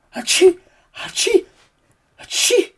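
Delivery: high sneezes, last one breathy; three acted sneezes; sneezing. Max sneezing three times after the puddle. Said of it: "dreimal niesen".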